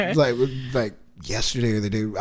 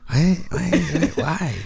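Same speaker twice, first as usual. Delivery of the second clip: slightly raised mimicking voice; mocking voice; 5 semitones higher, much slower than usual. Showing how they hear people talking, mocking. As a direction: repeat it as a silly mock imitation. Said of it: "when I hear people just saying shit".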